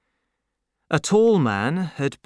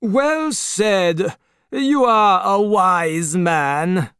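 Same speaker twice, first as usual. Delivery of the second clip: higher, slow, mocking aristocrat voice; Marquis cries out; 7 semitones higher, 5 dB louder, much slower than usual. A narrator's reading. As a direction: praise him with lofty condescension. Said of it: "cried the Marquis".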